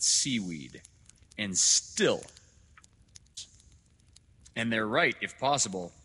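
loud, declamatory, emphatic lecture; lecturing doctor. Acted. Doctor lecturing father on history, loud and severe. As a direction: hammer each hardship home loudly. Dr. Hitz, a figure in the story.

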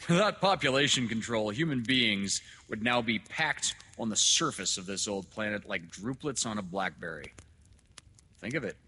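forceful lecture, starting loud and high; severe doctor. Acted. Doctor lecturing father on overpopulation, forceful and severe. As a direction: press the argument hard, then ease off. Dr. Hitz, a figure in the story.

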